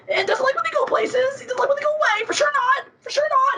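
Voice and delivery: very high squeaky whiny pet voice, near shouting; cat persona voice. Pretend. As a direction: play the silly cat character in a squealing falsetto. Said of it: "he talks like this".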